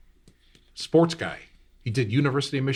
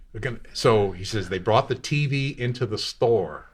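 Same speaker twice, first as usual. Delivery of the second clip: usual speaking voice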